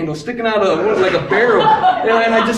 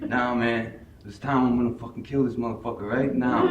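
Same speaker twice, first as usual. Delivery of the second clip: low, hushed inner threat; quiet menace; 8 semitones lower, 10 dB quieter than usual. Retold or imitated. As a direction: think it aloud, soft and low. Inner threat before attacking brother.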